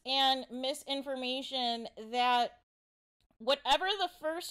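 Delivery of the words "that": normal talking voice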